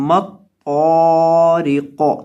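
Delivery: تو drawn out very slowly, قو ending with a bounce; slow recitation with qalqalah on قو. Shown as a demonstration. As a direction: bounce the final قو, stretch تو. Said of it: "اس کو ہم نے ہلانا ہے".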